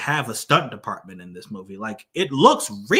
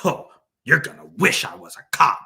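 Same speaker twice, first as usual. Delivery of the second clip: mock tough-guy line, a bit higher and louder; imitating the line; 4 semitones higher, 5 dB louder than usual. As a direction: ham up the tough threat. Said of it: "he says it just like that".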